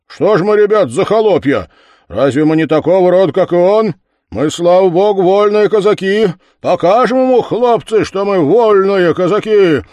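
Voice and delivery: very loud, high proud call to arms; defiant rallying cry. Acted. Левко rallies the lads, loud and defiant. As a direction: rouse the lads as free Cossacks.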